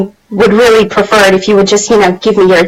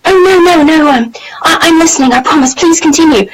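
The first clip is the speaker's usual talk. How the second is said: mock-flustered British protest, pitched higher; flustered posh British; 7 semitones higher than usual. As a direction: imitate a startled Englishman backpedalling politely. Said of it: "in the most British way".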